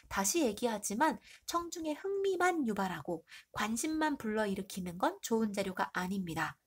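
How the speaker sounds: ordinary talk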